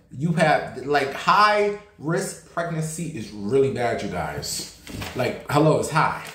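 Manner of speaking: ordinary delivery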